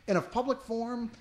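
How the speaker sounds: ordinary talk, nothing marked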